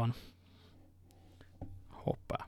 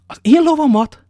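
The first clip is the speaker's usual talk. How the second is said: felkiáltás; 14 semitones higher, 13 dB louder than usual. Acted. D'Artagnan felháborodott kérdése.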